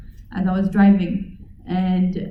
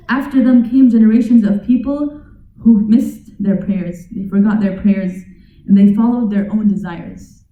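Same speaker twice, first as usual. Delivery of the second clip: louder scripture reading; formal translation reading; 6 dB louder than usual. Acted. Teacher translating the verse for his audience, louder formal read. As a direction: declare the verse's meaning firmly.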